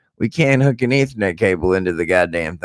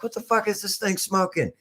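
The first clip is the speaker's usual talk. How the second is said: high squeaky disbelief; 7 dB quieter than usual. Retold or imitated. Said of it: "I'm like".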